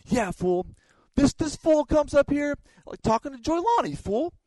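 high, loud, cocky storyteller voice; Shibata telling it. Retold or imitated. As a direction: brag it with swagger. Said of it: "this is how Shibata tells the story".

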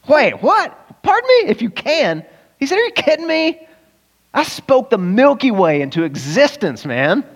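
high, incredulous "pardon me?" climbing sharply; mock disbelief. Jesus, taken aback by the father's doubt, in high mock disbelief. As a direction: play it like an outraged, disbelieving boss.

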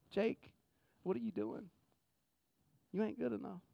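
hushed, accusing voice in the head; soft voice of self-doubt; little inner voice. Acted. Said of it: "I hear that little voice in my head".

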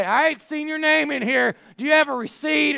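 high-pitched, put-upon clerk voice; exasperated store worker. Retold or imitated. Overworked lady who can't find his name, exasperated. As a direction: challenge the customer, hammed up and high. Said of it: "flabbergasted, exhausted".

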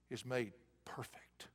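breathed whisper, slow; God whispering to Paul. Acted. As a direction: whisper the promise. Whispered line.

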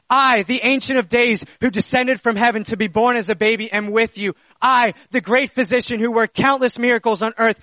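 impassioned preaching. Acted. Preacher proclaiming who Jesus is to believers, impassioned. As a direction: proclaim each title in an unbroken surge.

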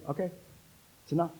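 softened, subdued reply; quiet acceptance. Acted. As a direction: drop the volume to settle the matter. Voices God gently ending Paul's pleading.

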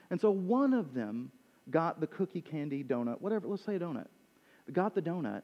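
ordinary talk, nothing marked

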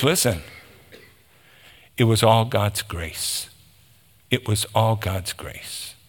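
low, soft and humble; humbly hushed. Acted. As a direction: drop your voice in quiet, heartfelt humility. Paul humbly crediting grace, acted.

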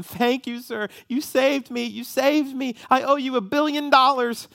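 put-on high, child-like voice full of thanks; grateful little girl. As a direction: gush like a small girl just saved. Rescued girls thanking him.

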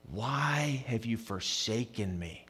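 soft, slow lament; barely voiced cry of abandonment; quiet lament; hushed anguished plea. Quoting Jesus quietly.

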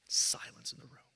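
whispered, breathy hush. Real, not acted. Preacher hushes the congregation recalling the silent room. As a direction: let the room go still.

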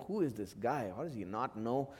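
quiet puzzled wondering. Retold or imitated. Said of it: "he's wondering".

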